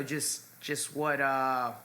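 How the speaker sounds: ordinary talk, nothing marked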